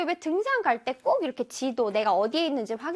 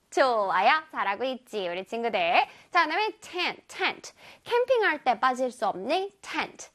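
ordinary talk

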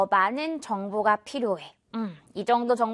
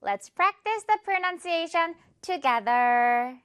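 higher, very slow English announcement; slow, higher practice announcement; slow announcer line; 6 semitones higher, much slower than usual. Shown as a demonstration. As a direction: announce the practice slowly and brightly. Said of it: "slow English announcement".

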